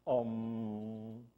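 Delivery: usual speaking voice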